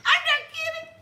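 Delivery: high laughing insistence. Real, not acted. Laughing to the audience after the shocking retort, high and giggly. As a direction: squeal it through a laugh.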